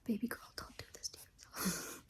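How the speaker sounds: whisper. Real, not acted. Whispered aside to herself.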